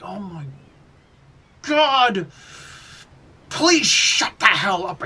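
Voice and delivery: drawn-out, loud plea rising high; exasperated outburst. Acted. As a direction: explode in hammed-up exasperation after a pause. Fed up with annoying character.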